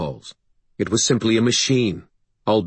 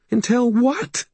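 high, rising challenge; alarmed interruption. A narrator's reading. Sarissa interrupting Harry's plan, high and alarmed. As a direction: cut in high and fearful.

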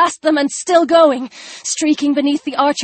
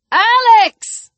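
high, drawn-out scream of a name; 9 semitones higher, 4 dB louder than usual. A narrator's reading. Chris screams after Alex as she rides away, screaming. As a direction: scream it to someone far down the trail. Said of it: "Chris screamed".

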